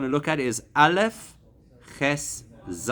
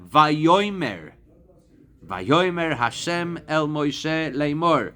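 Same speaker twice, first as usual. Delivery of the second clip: Vayomer Hashem el Moshe Leimor drawn out slowly; slow recitation; 3 semitones higher, much slower than usual. Shown as a demonstration. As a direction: recite the verse slowly as in prayer. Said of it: "We say it every single day".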